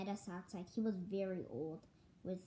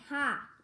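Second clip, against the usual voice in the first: scornful ha; 7 semitones higher, 11 dB louder than usual. Acted. Scoffing laugh.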